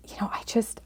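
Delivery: whispered, hesitant start; a mother's soft, hesitant whisper; hushed. Retold or imitated. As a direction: breathe it out unsure, nearly a whisper. Mom voiced, hesitant plea to son.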